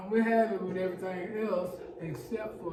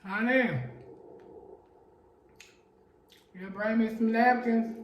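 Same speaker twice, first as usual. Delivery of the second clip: calling out; 3 semitones higher, 5 dB louder than usual. Real, not acted. Calling to someone in another room.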